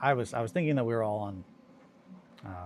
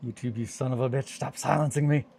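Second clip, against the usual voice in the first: louder, higher mock outrage at YouTube; mock angry yell; 4 semitones higher, 8 dB louder than usual. Streamer yelling at YouTube for muting him, mock outrage. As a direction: shake a fist at YouTube.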